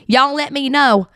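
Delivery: slow Southern country drawl on y'all let me know; country accent. Shown as a demonstration. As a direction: stretch it out in a playful country accent. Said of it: "I love doing my country accent".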